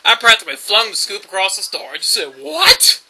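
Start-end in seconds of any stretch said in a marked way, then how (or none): 2.4-3.0 high, loud, baffled exclamation; incredulous outburst. Retold or imitated. Worker reacting to absurd chicken request, incredulous yelp. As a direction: burst out in mock disbelief.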